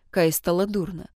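usual speaking voice

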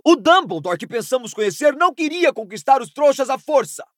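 high-pitched, impassioned angry yell; bellowing. Acted. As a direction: bellow it in outraged protest. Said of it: "berrou Harry".

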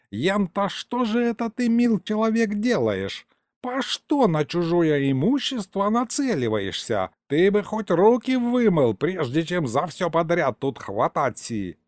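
put-on a rambling elderly grandfather, proud of himself; old grandpa storyteller. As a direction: tell it like a folksy old man, savouring every word.

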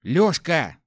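loud shout of a name. Acted. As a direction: call out loudly. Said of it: "крикнул я".

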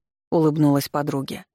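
plain reading voice

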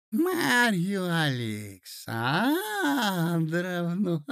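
put-on very slow, high, trembling old man; quavering old prince. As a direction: drag the name out, wavering. Prince repeats name, cry heard.

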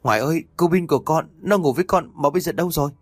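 high, fast frightened plea to grandma; panicked. Acted. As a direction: blurt it out in alarm. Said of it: "hốt hoảng". (hoảng hốt gọi bà, cao và nhanh)

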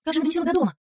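a touch of worry at a problem; puzzled. A narrator's reading. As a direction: ask it in mild bewilderment. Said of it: "озадаченно произнесла Конни".

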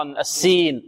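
usual speaking voice

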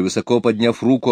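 ordinary delivery

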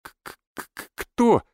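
nervous stutter on the first consonant; stammering. A narrator's reading. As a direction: stammer it out like a frightened nerd. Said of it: "заикаясь, спросил он". (испуганно заикаясь)

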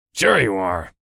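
understated spiteful aside; spiteful mutter. A narrator's reading. Boss doubts his driver's readiness, spiteful mutter. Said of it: "he muttered spitefully".

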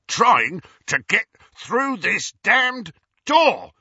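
high, loud, slow angry shout. A narrator's reading. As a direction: shout it in frustration. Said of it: "shouted Amycus".

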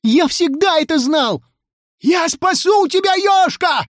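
very high, eager yell; high-pitched, jubilant outcry; excited shouting. A narrator's reading. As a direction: cry out a heroic promise. Excited crowd shouting in story.

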